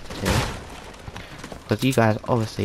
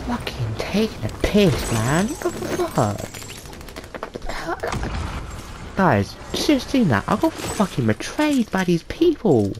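outraged rant; 9 semitones higher than usual. Real, not acted. Player complaining to viewers about his teammates, outraged. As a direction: let the betrayal explode. Raging at betrayal.

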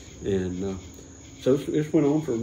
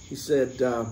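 ordinary delivery

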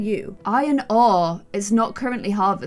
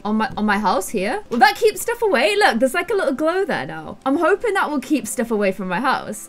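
ordinary delivery

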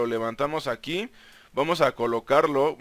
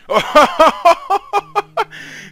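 squealing laugh of joy; high delighted laugh; 14 semitones higher, 9 dB louder than usual. Real, not acted. Burst of delight in the game.

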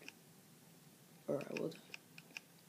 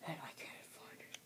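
breathy, softer frustrated whisper; 4 semitones lower, 16 dB quieter than usual. Real, not acted. Gamer to himself, can't find coal: whispered. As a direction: mutter it under your breath, unvoiced. Frustrated while mining alone.

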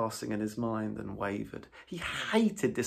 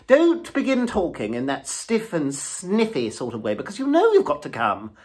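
higher, louder, coaxing plea; imploring Toad; 9 semitones higher, 12 dB louder than usual. A narrator's reading. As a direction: wheedle your friend into coming. Toad pleading with Rat.

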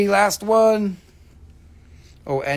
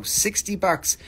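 ordinary talk

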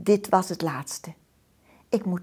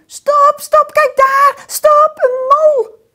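high, loud excited shout; child shouting; 13 dB louder, slower than usual. A narrator's reading. Girl shouting at opa to stop the bike, high and loud. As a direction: yell for them to stop. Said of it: "roept Soekie".

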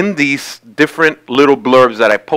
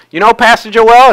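higher-pitched imitation of a Georgia accent; lightly mimicked Georgian accent; 7 semitones higher than usual. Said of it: "in her best Georgian accent".

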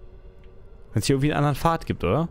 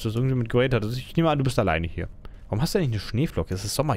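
normal talking voice

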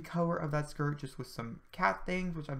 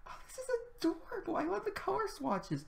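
much higher, squealing with delight. Real, not acted. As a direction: gush like you just found the cutest thing. Gushing over cute Sims items.

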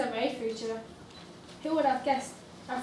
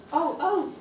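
surprised; 4 semitones higher, 6 dB louder than usual. Acted. Realizing Lavinia is near.

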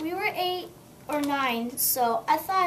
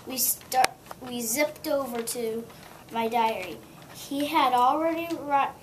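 usual speaking voice

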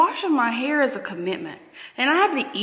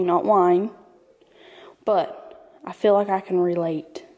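usual speaking voice

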